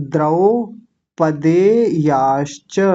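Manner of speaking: द्रौपदेयाश्च slowly with open द्रौ; teacher demo; slow syllables. Shown as a demonstration. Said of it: "यही वाली दो मात्राएं".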